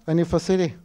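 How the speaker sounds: ordinary talk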